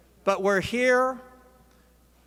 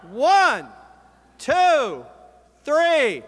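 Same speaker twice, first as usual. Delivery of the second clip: big, high count-in to a crowd; rousing countdown; loud countdown; 4 semitones higher, 6 dB louder, much slower than usual. Real, not acted. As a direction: count them in loud and long, each number falling.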